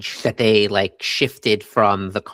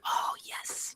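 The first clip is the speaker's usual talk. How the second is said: a very soft breathy whisper; barely voiced whisper of wonder; awed whisper; 16 dB quieter than usual. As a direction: breathe it out in quiet awe. Retelling awed reaction to scene.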